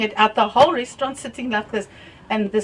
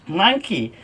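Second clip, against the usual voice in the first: ordinary talk, nothing marked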